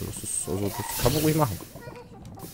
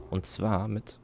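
usual speaking voice

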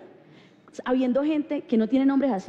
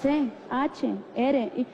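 spelling aloud; much slower than usual. Retold or imitated. Correcting the spelling slowly.

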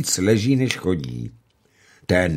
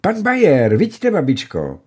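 high, loud eager cry; exclaiming; 11 semitones higher, 8 dB louder than usual. A narrator's reading. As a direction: call out the name in delight. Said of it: "baronka zvolala".

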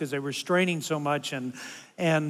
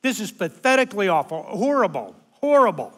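loud exaggerated whining, pitched up; complaining; 4 semitones higher, 4 dB louder than usual. Grandpa griping at the concert, loud exaggerated whine. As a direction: ham up the misery of bad seats. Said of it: "I'm complaining".

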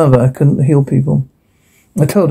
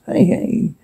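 urgent hush; 9 semitones higher, 6 dB quieter than usual. Acted. Spotting someone arriving, urgent hush.

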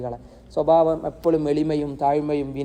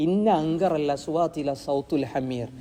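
slow, chanted Arabic verse; Quran recitation; slower than usual. Acted. Preacher reciting the verse to his listeners, slow chant. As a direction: intone the verse slowly, as in the mosque.

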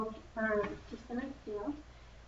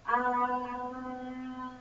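tongue out saying aah. Real, not acted. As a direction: mouth wide open, tongue out, aah. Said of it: "open your mouth and stick out your tongue and say".

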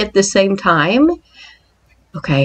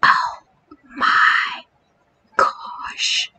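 awed whisper; 7 semitones higher, slower than usual. Real, not acted. Whispering at the skeletons.

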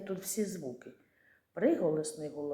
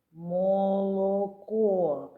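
"Молоко" stretched very slowly, louder, leaning on each о; slow sounding out; 5 dB louder, much slower than usual. Shown as a demonstration. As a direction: draw out every о in "Молоко". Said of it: "Звук «о»". (дуже повільно, наголошуючи о)

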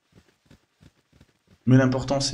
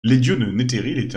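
ordinary talk

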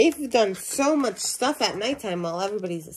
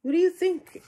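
usual speaking voice

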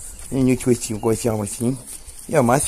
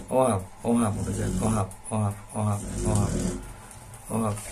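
soft cooing; 5 semitones lower, 8 dB quieter, much faster than usual. Real, not acted. Cooing at dog's tail.

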